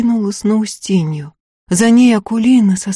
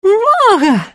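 very high, tearful cry; tearful outburst; much slower than usual. Acted. Marya to Antip, high tearful protest. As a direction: wail it near tears. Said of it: "слезливо вскинулась".

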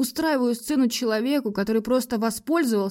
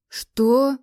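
louder, higher startled question; a startled, raised query; taken aback; 5 semitones higher, 5 dB louder than usual. A narrator's reading. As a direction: caught off guard. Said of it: "растерялся Макс".

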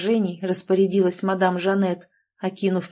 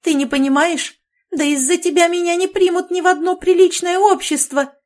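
high, loud shriek of outrage; mother shrieking at her daughter in horror; 10 semitones higher, 6 dB louder than usual. A narrator's reading. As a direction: squeal it at the top of her voice. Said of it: "взвизгнула мать".

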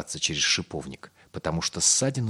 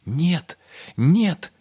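high, loud cry of refusal; shouted protest; 11 semitones higher, 6 dB louder than usual. A narrator's reading. Little Pip protests to his accuser, crying out. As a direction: cry out in denial, pitched high. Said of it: "крикнул Пип".

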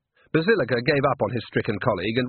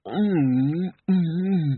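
drawn-out high "mm"; wordless mouthful of approval; appreciative humming; 6 semitones higher, much slower than usual. A narrator's reading. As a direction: hum with relish over food. Diner with full mouth replies.

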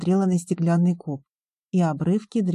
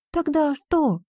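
exclaiming; 9 semitones higher, slower than usual. A narrator's reading. Риона challenges the would-be conqueror, exclaiming. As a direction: exclaim it high as the heroine. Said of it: "воскликнула Риона".

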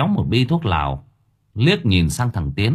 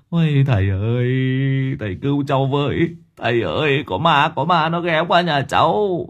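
higher, pleading and stumbling; stammering in fear; 5 semitones higher than usual. Acted. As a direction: beg the master for help, tripping over words. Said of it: "Lão hoa giấy lắp bắp".

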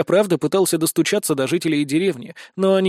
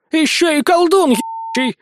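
very high, outraged yell; granny's yell; 11 semitones higher, 4 dB louder than usual. A narrator's reading. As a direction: screech the accusation like an angry granny. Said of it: "заорала бабка". (истошный крик разъярённой бабки)

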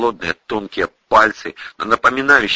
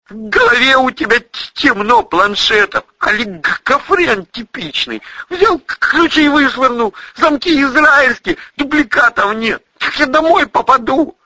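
high, loud, stammering rant; furious high-voiced stutterer; agitated stuttering soldier; 4 dB louder than usual. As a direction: pile up accusations in a frantic high voice.

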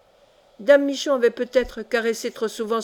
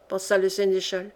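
plain reading voice

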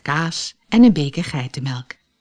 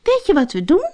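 put-on much higher, bright-eyed girl with an idea; eager little girl; 11 semitones higher, 3 dB louder than usual. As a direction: pitch it up like an excited child. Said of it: "zei Heidi".

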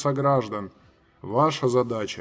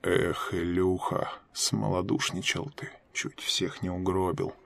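low muttered under the breath; muttering; 5 semitones lower, 5 dB quieter than usual. A narrator's reading. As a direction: mutter it to yourself, low and soft. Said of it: "пробормотал под нос". (бормочет себе под нос)

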